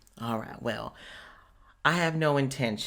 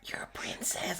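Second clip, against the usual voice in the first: awed whisper; 4 semitones higher, 9 dB quieter than usual. Acted. Stunned whispered realization.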